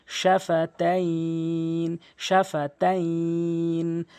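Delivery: slow, level recitation of "শাফাতাইন" with a stretched last syllable; drawn-out pausal ending. Shown as a demonstration. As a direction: hold the final "ই" of "শাফাতাইন" long before n. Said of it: "এক লিফ টেনে পড়তে হবে।". ("শাফাতাইন"-এর শেষ স্বর টেনে পড়া)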